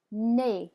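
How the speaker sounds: "nez" as "nay", Z silent; French pronunciation. Shown as a demonstration. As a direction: model "nez" to rhyme with English play. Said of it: "Go ahead and say that".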